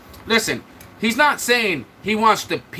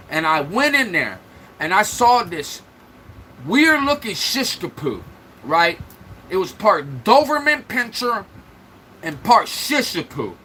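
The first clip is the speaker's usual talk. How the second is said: normal talking voice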